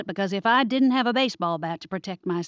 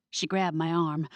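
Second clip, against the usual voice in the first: ordinary delivery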